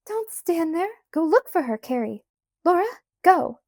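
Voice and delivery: high, loud, anxious orders; urgent command. A narrator's reading. Ma orders the girls to look for Grace, urgent. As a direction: send them off to search at once.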